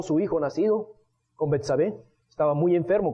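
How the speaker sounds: usual speaking voice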